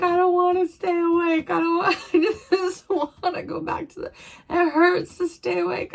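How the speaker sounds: real tears pushing the words up and out; crying. Real, not acted. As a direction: voice breaking high as she cries. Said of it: "I told myself I would not cry".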